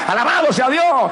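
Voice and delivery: rising, shouted cries of vida; full-force preaching. Acted. Preacher crying out life to the congregation, shouting.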